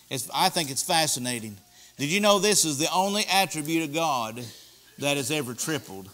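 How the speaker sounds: normal talking voice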